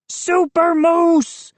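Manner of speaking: slow, high hammed-up hero bellow. A narrator's reading. Moose brags to his friends, slow hero bellow. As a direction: stretch out Super Moose like a superhero. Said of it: "he bellowed".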